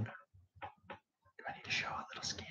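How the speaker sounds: teasing whisper aside; joking whisper. Pretend. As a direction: whisper a cheeky joke to the chat. Joking about the stream view.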